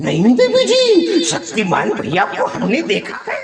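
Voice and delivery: very high, squeaky put-on voice; exaggerated high-pitched mimicry; cartoonish character voice. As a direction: squeal it way up high, then wobble down.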